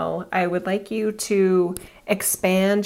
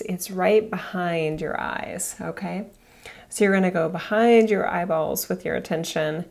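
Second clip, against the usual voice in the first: guided meditation; 3 semitones lower, 3 dB quieter than usual. Acted. Guides elevator visualization meditation.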